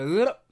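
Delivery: loud slurping lick noise; exaggerated tongue-slurp sound; imitated lick. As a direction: mime a big noisy lick. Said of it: "You go".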